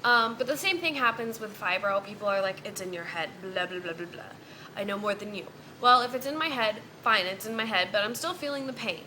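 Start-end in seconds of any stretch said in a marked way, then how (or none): 2.6-5.5 softer, lower dismissive mimicry; mocking quote. Vlogger imitates people calling fibro pain imaginary, softer and mocking. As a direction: toss it off like a know-it-all you despise.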